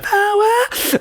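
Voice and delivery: imitated metal wail in very high falsetto; piercingly high mock harmony cry. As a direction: push the wail right up to the top. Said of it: "Could you go even higher?".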